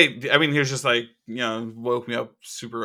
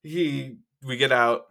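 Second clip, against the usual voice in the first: ordinary talk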